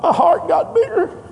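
lightly voiced little boy, amazed; imitating a little child. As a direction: say it like the small grandson in wonder. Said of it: "He felt something on the inside".

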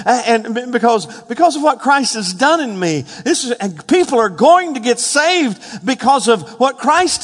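excited rush building to a loud, high-pitched doxology; building excitement. Acted. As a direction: build from eager explanation to exultant proclamation. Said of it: "the inflection and the excitement in his life".

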